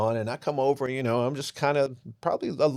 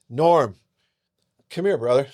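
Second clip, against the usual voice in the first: louder call across the yard; 3 semitones higher, 7 dB louder than usual. Retold or imitated. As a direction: call her brother over. Neighbor calls to her brother.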